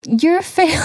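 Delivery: high mock shout; mock stern shout. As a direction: bark it out like a fed-up uncle. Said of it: "I start telling Noah".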